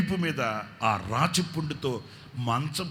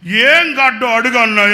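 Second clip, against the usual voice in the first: high, loud exasperated shout; loud retort; 10 semitones higher, 17 dB louder than usual. Retold or imitated. Wife shouting at her husband over the boil. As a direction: fling it back at him.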